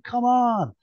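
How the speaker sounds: plain reading voice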